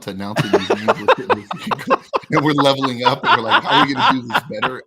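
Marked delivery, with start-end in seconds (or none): 0.9-4.8 high, loud laughing quote; cracking up mid-sentence. Real, not acted. Laughing while quoting themselves.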